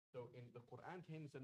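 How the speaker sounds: usual speaking voice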